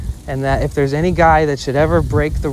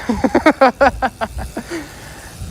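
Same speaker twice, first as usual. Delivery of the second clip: high, rising burst of laughter; real laugh; 8 semitones higher than usual. Real, not acted. Laughing to the listener about lighting the cigarette.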